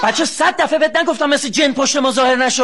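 high, loud angry shout; shouted parental telling-off; startled scolding. Acted. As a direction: snap loudly at the child who surprised you. Startled father scolds intruding child.

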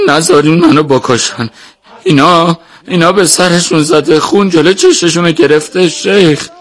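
high, urgent begging for your life; desperate pleading. A narrator's reading. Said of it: "با التماس گفت".

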